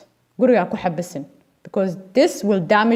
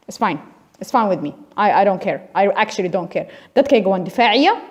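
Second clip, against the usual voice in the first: normal talking voice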